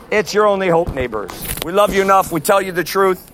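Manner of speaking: ordinary talk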